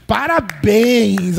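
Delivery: very slow, dripping mock praise; sarcastic congratulations. Wife mocking her husband, slow sarcastic praise. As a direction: drag it out to needle him. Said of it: "Ela não chegou ironizando ele".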